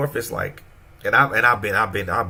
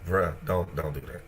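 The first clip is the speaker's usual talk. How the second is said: muttered warning; 5 semitones lower, 8 dB quieter than usual. Retold or imitated. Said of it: "They'll look at you like".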